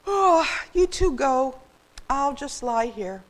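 slow, high, weary sigh; drawn-out sleepy voice; yawning. A narrator's reading. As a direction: give up lazily through a yawn. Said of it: "he yawned".

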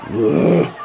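ordinary talk, nothing marked

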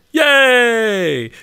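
high loud delighted cheer; cheering yay. Real, not acted. Cheering as the pig walks into the pen. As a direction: celebrate the win.

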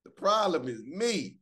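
owning up. Pretend. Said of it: "we gotta be like".

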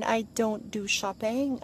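usual speaking voice

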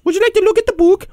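very high squeaky put-on voice; interrupting book pusher. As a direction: cut in with a relentless high-pitched offer. Repeated interrupting catchphrase.

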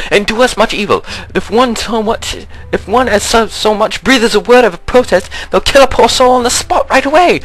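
high, fast frightened peasant voice; high pleading villager. Acted. Reads villager dialogue aloud.

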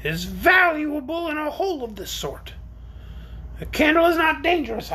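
high creature voice, a touch uneasy; somewhat nervous orc. A narrator's reading. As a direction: speak up high as a mildly worried orc. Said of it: "it said, somewhat nervously".

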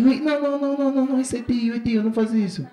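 high, loud rush of protests; urgent pleading. Retold or imitated. A father rushing to stop his son hitting a guest. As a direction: hurry to stop the boy.

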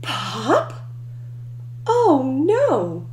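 ordinary delivery, nothing marked

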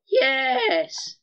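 cracking excited yell. Real, not acted. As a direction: let the voice break on a loud yes. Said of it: "Oh my god, the voice cracks".

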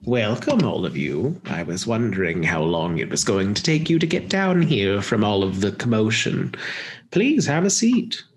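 swooping theatrical hostess voice, pitch sliding up and down; lilting, exaggerated invitation to sit; hostess villain, welcoming. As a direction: play a villain grandly welcoming unwanted guests. Game master plays the hostess.

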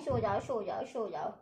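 mild imitation of mama's soothing bedtime murmur; imitating a lullaby. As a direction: lull a baby off gently. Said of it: "ऐसे सुलाती हैं".

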